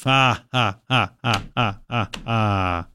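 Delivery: low, spaced-out mock laugh; deliberate spoken laughter, no real amusement; fake laugh. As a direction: drop out fake ha-has, fading away. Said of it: "we once again will laugh".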